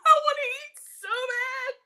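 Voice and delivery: high, laughing, desperate craving; giddy hungry laugh. Real, not acted. Hungry cook eyeing her plate, high giddy laugh. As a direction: laugh through the hunger. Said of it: "I'm shaking".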